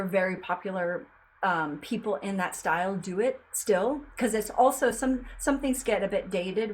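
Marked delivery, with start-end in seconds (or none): none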